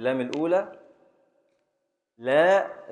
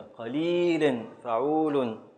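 slow scansion; much slower than usual. Shown as a demonstration. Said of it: "أحسنت، تامة".

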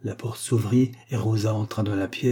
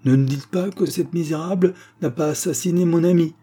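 high, louder outraged exclamation; crying out; 8 semitones higher, 5 dB louder than usual. A narrator's reading. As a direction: cry out in dramatic disbelief. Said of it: "s'écria mademoiselle Lyle". (s'écrier, indignée)

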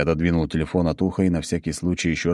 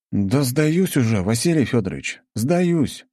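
giving in; 7 semitones higher than usual. A narrator's reading. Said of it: "не выдержал я".

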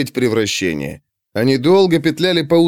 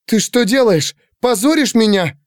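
high-pitched, outraged scolding; indignant attack; 9 semitones higher than usual. A narrator's reading. Girl rounding on her servant outside, indignant. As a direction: lash out in a raised pitch. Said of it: "Пандора набросилась на Алексиуса".

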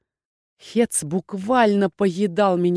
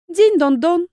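tiny creature squeak; 9 semitones higher, 5 dB louder, slower than usual. Acted. Flower creature speaks.

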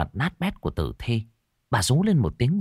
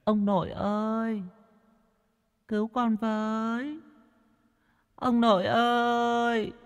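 put-on very high, soft, drawn-out child's voice; distant, thin plea for help; grandchild calling in a dream; 5 dB quieter, slower than usual. A narrator's reading. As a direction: call faintly for grandpa, stretching each cry. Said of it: "phía ông nội mà gọi".

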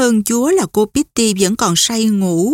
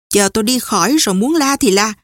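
ordinary delivery, nothing marked

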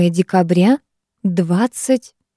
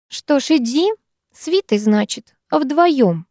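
feigned indifference; 7 semitones higher, slower than usual. A narrator's reading. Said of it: "попыталась изобразить безразличие".

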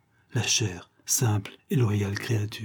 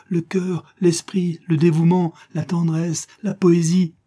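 raised, louder, impassioned outpouring; ardent declaration; 6 semitones higher, 5 dB louder than usual. Acted. Lover declaring his adoration to the woman, ardently. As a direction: pour out the praise with mounting fervour.

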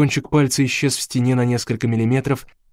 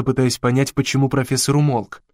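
usual speaking voice

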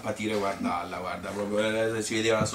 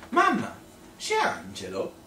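high, laughing imitation of a frantic boy; child calling mum; 12 semitones higher, 3 dB louder than usual. Cousin calling his mother about the drowning boy, high laughing child voice. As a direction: mimic the little cousin shouting for his mother. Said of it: "gli disse".

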